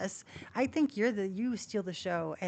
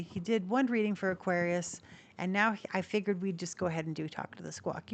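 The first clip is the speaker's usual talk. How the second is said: ordinary talk, nothing marked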